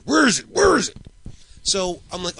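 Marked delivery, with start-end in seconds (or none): 0.0-1.0 high, loud mock yelling; mock angry yell. Boss screaming at monkeys at typewriters, mock angry yell. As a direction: bark it like a furious boss.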